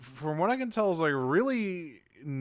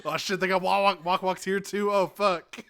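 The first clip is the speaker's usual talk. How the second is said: higher, louder mock panic; freaking out; 6 semitones higher, 4 dB louder than usual. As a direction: rattle off an acted freak-out. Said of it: "Bumblebee immediately freaks out".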